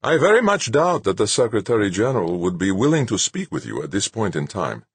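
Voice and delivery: reedy but melodious. A narrator's reading. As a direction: speak in a light, melodious pipe. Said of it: "his voice reedy but melodious".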